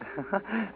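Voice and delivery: a little laugh at the debtor's expense; quick soft laugh; short chuckle. Acted. Lawyer chuckles over his business.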